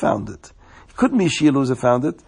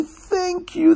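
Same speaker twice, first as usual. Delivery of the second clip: gushing thanks. Acted. Grateful man thanks finder.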